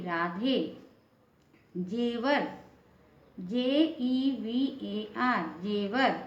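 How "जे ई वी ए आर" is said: letters spelled out slowly one by one; slow spelling. Shown as a demonstration. Teacher spelling a word slowly for students. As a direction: dictate each letter slowly for the class. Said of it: "teacher spells out letters".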